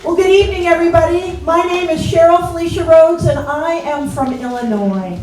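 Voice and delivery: big hello to the whole audience; stage greeting. Real, not acted. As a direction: project it to the back row.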